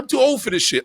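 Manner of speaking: high, worked-up imitation of a complaining pal; exasperated pleading. Tired buddy begging to go home, exasperated high whine. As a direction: protest like you cannot take another hour.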